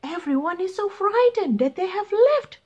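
high, pleading pilot voice, quick on the request. Acted. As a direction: beg the beast for help. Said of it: "said the pilot".

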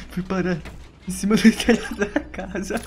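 slow words breaking into laughter; amused laughing. Real, not acted. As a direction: laugh your way through the phrase. Laughs at where he ended up. (falando e rindo)

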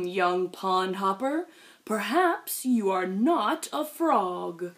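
put-on low, slow, musing old sage; wise old pond elder. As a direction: speak slowly like an ancient pond elder. Wise old creature voiced slowly.

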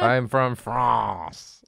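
put-on very slow, drawn-out French accent. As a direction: stretch it out like a cartoon Frenchman. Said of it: "tries to do a French accent".

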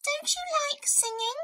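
very high little mouse question; high, small storybook mouse; puzzled mouse. A narrator's reading. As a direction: ask innocently as a tiny mouse. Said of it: "asked Mouse".

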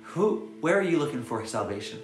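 ordinary talk, nothing marked

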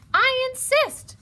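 high, loud insistence; emphatic high hedgehog voice; insistent. A narrator's reading. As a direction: press the point firmly, pitched high. Hedgehog insists Mouse come along.

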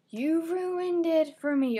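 high, loud, drawn-out mock whine; mock complaint. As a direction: imitate an aggrieved viewer, stretching the words. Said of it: "coming to me and saying".

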